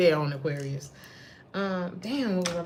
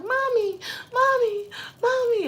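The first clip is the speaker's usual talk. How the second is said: put-on high little-boy voice calling Mommy; squeaky toddler tugging at mom, high and loud; 5 dB louder than usual. Dream boy looking up, calling his mom in a high child voice. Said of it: "He's like".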